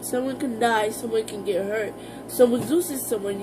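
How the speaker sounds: normal talking voice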